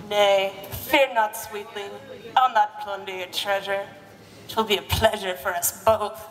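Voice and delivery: low mock Scottish brogue with a grin in it; hammed-up Scottish hero, low and sly; devilish Scottish lover. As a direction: reassure her like a roguish Highlander. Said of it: "with a devilish grin".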